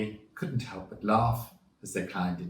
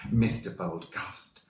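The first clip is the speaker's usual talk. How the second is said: plain reading voice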